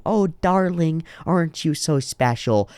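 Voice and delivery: high, slow, cooing condescension; fake smiling sweetness. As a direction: coo it through a put-on smile. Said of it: "everybody around them is smiling at them going".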